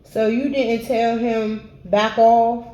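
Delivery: ordinary delivery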